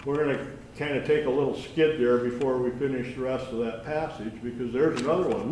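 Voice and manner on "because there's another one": ordinary talk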